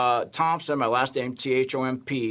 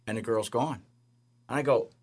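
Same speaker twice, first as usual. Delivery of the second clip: ordinary talk, nothing marked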